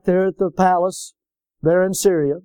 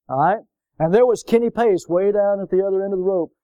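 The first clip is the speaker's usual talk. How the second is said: ordinary delivery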